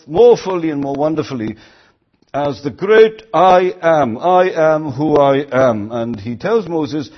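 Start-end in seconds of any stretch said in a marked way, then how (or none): 2.3-5.9 proclaiming. Acted. Preacher proclaiming God's name.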